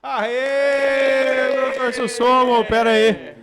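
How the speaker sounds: loud, high cheering welcome; excited cheer. Real, not acted. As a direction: cheer the guest in.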